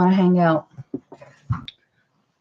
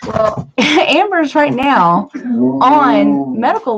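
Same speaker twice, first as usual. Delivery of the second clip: crying; 3 semitones higher, 5 dB louder, much slower than usual. Real, not acted. Talking about stress, voice breaks.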